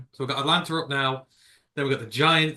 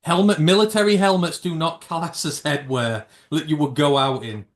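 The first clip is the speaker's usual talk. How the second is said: higher, louder insistence; louder, higher exasperated no; exasperated protest; 5 semitones higher, 7 dB louder than usual. Real, not acted. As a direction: shut down his helmet idea. Exasperated at co-host's hat.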